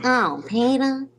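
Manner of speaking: slow, drawn-out Lois Griffin sigh; slow exasperated cartoon wife; Lois impression. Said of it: "sounded just like Lois".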